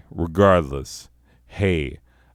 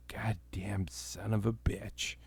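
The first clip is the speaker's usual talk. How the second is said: unvoiced hissed whisper; muttered swearing in a whisper; whispered curse; 3 semitones higher, 12 dB quieter than usual. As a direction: curse it under your breath. Curses under breath.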